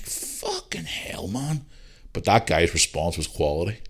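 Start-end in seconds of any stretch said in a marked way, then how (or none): 0.0-1.7 whispered, slow disbelief; stunned whisper. Retold or imitated. Podcaster reacting to a child who wants to be a cat, whispered in shock. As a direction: breathe it out in shock. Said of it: "and I'm like".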